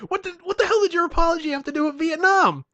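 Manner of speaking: high, loud, incredulous shout; hammed-up exasperated rebuke; exasperated yelling. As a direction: yell in baffled comic outrage. Acted quarrel, exasperated friend.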